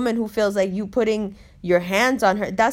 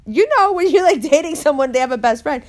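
excited aside; 5 semitones higher, 5 dB louder than usual. Real, not acted. Aside to the audience about best friends, excited burst. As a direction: burst out higher and louder.

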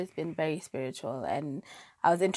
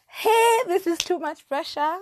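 mock panic; 11 semitones higher, 8 dB louder than usual. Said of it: "And I'm just like".

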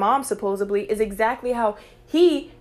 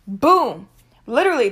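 normal talking voice

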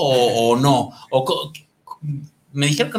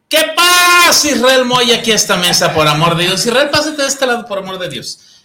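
hammy stage actor; 5 semitones higher, 6 dB louder than usual. Pretend. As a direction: call him over like a stage villain. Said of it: "host mock-dramatic summons". (llamado teatral exagerado)

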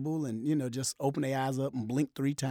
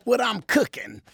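high, louder Rock catchphrase; wife as The Rock; 10 dB louder than usual. Retold or imitated. Wife answering with a wrestling catchphrase, higher voice. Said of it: "she said".

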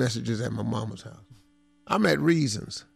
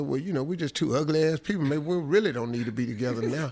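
quick retold comeback; 3 dB quieter, faster than usual.

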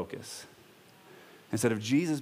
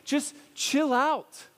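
very high, drawn-out chill out; Paul calming; 12 semitones higher, much slower than usual. Retold or imitated. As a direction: stretch it out, much higher. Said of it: "And so he's going".

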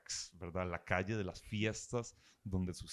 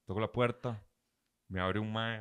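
ordinary delivery

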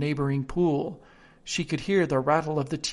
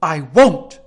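defiant; 13 dB louder than usual. Acted. Alice defies the queen.

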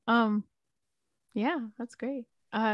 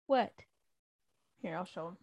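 ordinary talk, nothing marked

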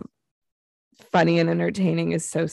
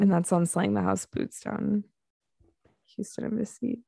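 ironic zoomer; 4 dB quieter than usual. Pretend. Ironic slang chant.

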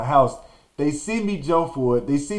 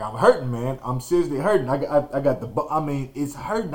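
ordinary talk, nothing marked

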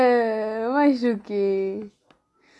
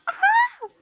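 very high short squeal of joy; delighted high squeal. Retold or imitated. Reacting with joy as the boys tell the girl to shut up, high squeal. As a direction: squeal like a fangirl. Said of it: "E eu tipo".

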